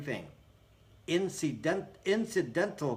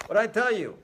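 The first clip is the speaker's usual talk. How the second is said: gloating; 6 semitones higher, 5 dB louder than usual. Real, not acted. Gloating to viewers.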